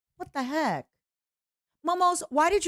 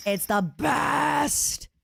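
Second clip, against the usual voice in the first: higher and very slow, stretching each word; slow gushing; 6 semitones higher, much slower than usual. Shown as a demonstration. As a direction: savour "best" like a dreamy sigh. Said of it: "repeated, stretched".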